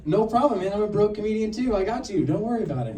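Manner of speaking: mock eager buddy; eager reassurance. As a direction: jump in keen to reassure.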